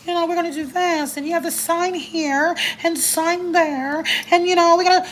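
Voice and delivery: fake caseworker. Caseworker walking a parent through paperwork, phony and high. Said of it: "she can see you being fake".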